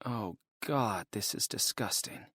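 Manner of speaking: softly whispered disgust to herself. Acted. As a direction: breathe it in disgust under her breath. Sitting on soggy seat.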